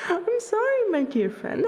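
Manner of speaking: high-pitched teasing apology; playful apology. Acted. Dancer who has just teased a friend, playfully apologetic.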